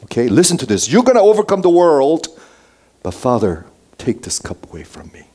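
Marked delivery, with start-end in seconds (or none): 0.9-2.3 raised, high, emphatic proclamation; triumphant declaration. Acted. Preacher quoting Jesus to the congregation, triumphant. 3.0-5.3 low, soft, subdued prayer; quiet plea. Acted. Preacher voicing Jesus praying to the Father, hushed plea.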